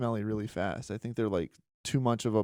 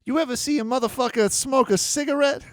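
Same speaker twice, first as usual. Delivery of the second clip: foul-mouthed granny ranting, pitched way up; 8 semitones higher, 6 dB louder than usual. Retold or imitated.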